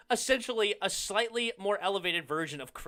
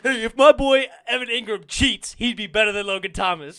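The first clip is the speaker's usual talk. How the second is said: loud, laughing comeback; laughing retort; 3 semitones higher, 9 dB louder than usual. Real, not acted. Answering the rival's jab, loud and laughing. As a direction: fire it back while still laughing.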